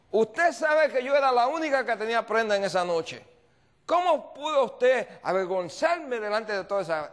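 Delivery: high, loud tirade of reproach; angry yelling. Retold or imitated. Woman accusing pastor of shaming her, acted angry yelling. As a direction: rail at him as the humiliated woman. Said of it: "Y comienza a gritarme".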